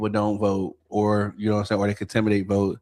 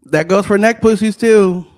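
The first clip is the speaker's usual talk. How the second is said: loud, high-pitched comic politician voice; Trump; 9 semitones higher, 10 dB louder than usual. Acted. Chiming in on the church amen, loud Trump impression. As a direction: belt it out in a put-on presidential voice. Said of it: "Says the Trump pussy man".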